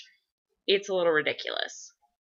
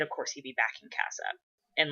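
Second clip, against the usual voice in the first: usual speaking voice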